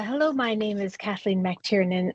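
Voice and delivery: ordinary talk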